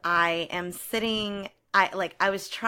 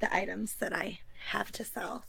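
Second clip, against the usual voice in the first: secretive whisper, much softer; 8 dB quieter than usual. Real, not acted. Joke about sitting next to items.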